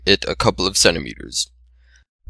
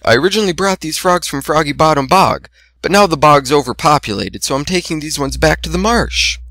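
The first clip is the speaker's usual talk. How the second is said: higher gentle shy voice; timid Fluttershy; 6 semitones higher than usual. A narrator's reading. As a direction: explain shyly, pitched up.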